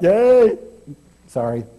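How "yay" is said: a loud, high burst of cheering; excited cheer. Real, not acted. Preacher cheering for his hockey team, loud and excited. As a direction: cheer for the team before catching yourself. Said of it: "Forgot myself for a minute".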